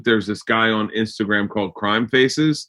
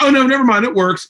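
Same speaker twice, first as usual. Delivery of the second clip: mock excitement; 11 semitones higher than usual. Quoting a sudden change of mind.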